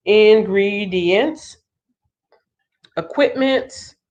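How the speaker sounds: "Ingredients" drawn out slowly with a rise; slow dictation. Shown as a demonstration. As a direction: spell out "Ingredients" slowly while writing. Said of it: "I'll just put down ingredients".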